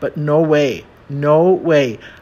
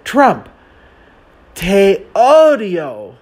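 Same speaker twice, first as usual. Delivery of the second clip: slow telenovela declaration, high and loud; hammed-up soap-opera drama; very dramatic; 5 semitones higher, 5 dB louder, much slower than usual. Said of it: "said very dramatically".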